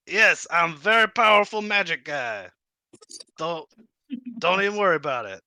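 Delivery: high, boastful kobold. Acted. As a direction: brag like a puffed-up kobold. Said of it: "the kobold voice is contagious".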